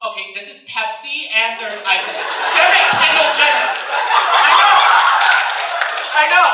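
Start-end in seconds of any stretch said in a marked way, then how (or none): 2.5-6.5 loud, high, laughing shout; mock fury at Kendall Jenner; comic outburst. Real, not acted. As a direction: burst out in mock rage.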